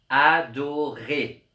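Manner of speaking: adorer said slowly with a loud final rer; slow stressed ending. Shown as a demonstration. Teacher showing that adorer rhymes with ré, slow and loud. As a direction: stretch out adorer, hitting the end. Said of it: "La rime, c'est ré".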